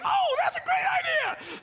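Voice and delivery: very high, squealing cartoon cheer; hammed-up squeaky delight; cartoon character. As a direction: imitate an overjoyed little cartoon. Cartoon delighted by the new answer.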